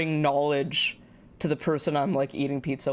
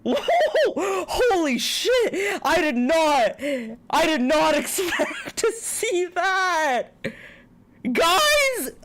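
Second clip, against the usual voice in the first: shrieking jackpot winner; 11 semitones higher, slower than usual. Real, not acted. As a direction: shriek like you just won big. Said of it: "I'm sorry if I scared the shit out of you guys".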